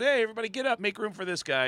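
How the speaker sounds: high-pitched call to a row of people; imitating someone clearing a path. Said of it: "And he goes".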